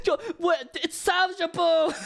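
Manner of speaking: high, giggly attempt at a German accent; failed mock-German exclamation through laughter; bad German accent. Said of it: "how to do a German accent".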